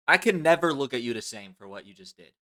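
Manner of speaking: ordinary talk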